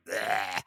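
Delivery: imitated vomiting noise; a loose, throaty mock retch. Telling a bathroom vomiting story, mock retching.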